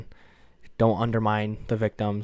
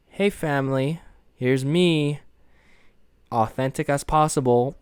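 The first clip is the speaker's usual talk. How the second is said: mock announcement; 4 semitones higher, 6 dB louder than usual. Imagined announcement to her family.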